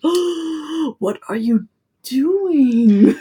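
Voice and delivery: high shocked "Oh", slow question; horrified gasp. Retold or imitated. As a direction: clutch your pearls at the sink. Horrified at brush abuse.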